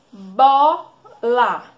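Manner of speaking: "Bola" with BO loud and drawn out; "Bola" in separated syllables, first one strongest; stressed first syllable. Shown as a demonstration. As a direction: sound out "Bola" slowly, leaning hard on BO. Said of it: "Qual é a sílaba mais forte".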